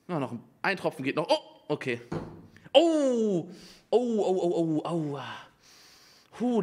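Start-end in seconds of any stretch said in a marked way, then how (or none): none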